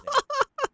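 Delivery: sharp incredulous burst of laughter. Real, not acted. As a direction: laugh in disbelief. Laughing at the absurd news.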